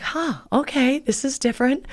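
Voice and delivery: mildly taken aback, quietly; puzzled reaction. Retold or imitated. Woman walks into a spa-like restaurant, quietly puzzled. Acted reaction to unexpected place.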